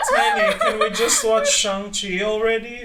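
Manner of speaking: a mock whine to get the movie started; whiny friend. Friend nags Tiny to finally watch Shang-Chi, mock whine. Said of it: "It's like".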